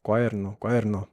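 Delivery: cuaderno said as cuaerno, no D at all; dropped D. Shown as a demonstration. Teacher modelling the Chilean line with the D lost. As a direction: cuaderno with the D swallowed, cua-erno. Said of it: "hace lo mismo con la D de cuaderno".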